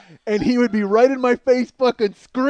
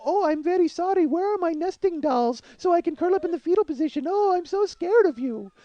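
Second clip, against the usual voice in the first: high, meek mock Russian accent; pitched-up Russian accent, put-on frightened victim; Russian mail-order bride; 8 semitones higher than usual. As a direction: dial it down into a helpless Russian bride. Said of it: "dialed it down to go".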